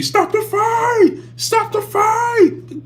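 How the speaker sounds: high, loud, drawn-out cry; mock yelled game voice. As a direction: imitate a game's piercing slow yell. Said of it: "somebody's yelling".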